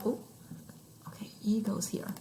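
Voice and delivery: normal talking voice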